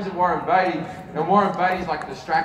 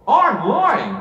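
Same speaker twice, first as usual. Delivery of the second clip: higher blurted admission; higher sudden confession; 7 semitones higher than usual. Retold or imitated. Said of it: "I just went like this".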